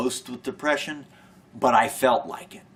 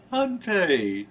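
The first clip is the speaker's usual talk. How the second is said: laughing; 7 semitones higher than usual. Real, not acted. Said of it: "without laughing".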